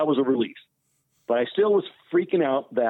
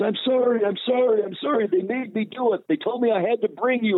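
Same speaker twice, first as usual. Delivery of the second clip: apologetic young guy, voiced much higher; pleading kid; 8 semitones higher than usual. The kid apologizing for bringing him there, voiced high and pleading. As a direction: beg forgiveness, repeating the apology. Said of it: "he says".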